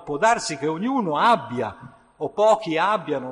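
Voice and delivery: loud, impassioned preacher's voice; fervent preaching. Acted. Preacher urging listeners toward the Spirit, loud fervor. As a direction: pour the longing of the thirsty soul into it.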